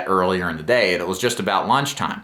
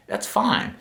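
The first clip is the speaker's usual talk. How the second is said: accepting, reassuring; 10 semitones higher than usual. Shown as a demonstration. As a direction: let them know it's okay they feel that way. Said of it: "That is the tonality you wanna say it".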